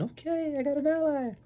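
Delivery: tiny high voice of pretend relief; hopeful inner voice. Thinking he has found an ally, high mock hope. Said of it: "I'm thinking".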